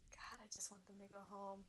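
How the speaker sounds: breathy whispered wish. Real, not acted. Host longing for the turtles to return, whispered. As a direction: whisper it as if to yourself. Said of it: "whispered wish after sad scene".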